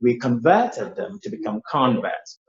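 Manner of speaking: converted with VERT stressed, converts with CON stressed; verb against noun stress. Shown as a demonstration. Teacher repeating the example, contrasting stress on converted and converts. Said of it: "look at the verb form".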